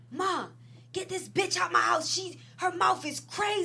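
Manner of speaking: high-pitched, imitated tattling to mom; retold complaint to mom. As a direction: complain like he's telling on someone to his mother.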